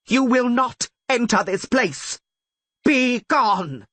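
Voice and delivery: commanding scream. Acted. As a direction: blast them away with the words. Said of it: "she screamed again".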